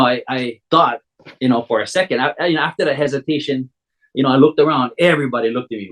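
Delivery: ordinary talk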